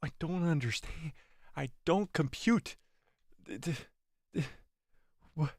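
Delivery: quiet, dazed disbelief; stunned and bewildered. Real, not acted. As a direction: mumble it, completely lost. Stunned after the episode.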